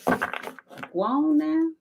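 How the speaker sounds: very slow, high and drawn out; drawn-out high shoo. Acted. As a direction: stretch it out high and slow, shooing gently.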